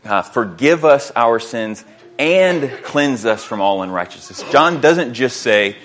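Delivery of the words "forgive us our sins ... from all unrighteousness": two weighted halves, "and" held long between them; dual emphasis. Shown as a demonstration. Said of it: "notice the dual emphasis in that verse".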